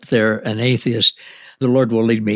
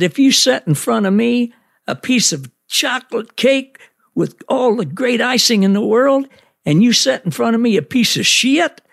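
put-on Southern accent; 12 semitones higher than usual. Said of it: "he has a real Southern accent".

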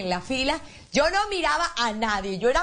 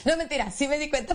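usual speaking voice

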